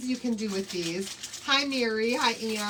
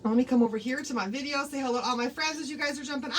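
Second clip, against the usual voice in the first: usual speaking voice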